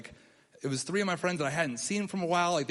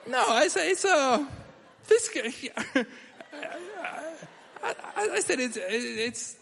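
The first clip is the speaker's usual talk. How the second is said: flustered stammer; 8 semitones higher than usual. Retold or imitated. Flustered excuse to boss.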